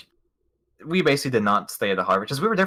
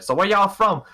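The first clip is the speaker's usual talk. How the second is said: imitating the guide, higher and louder; Boston guide; 9 semitones higher, 5 dB louder than usual. Friend imitating the Boston guide asking the tourists where they're from, higher and louder. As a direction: ask it like a chummy Boston tour guide. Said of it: "he's like".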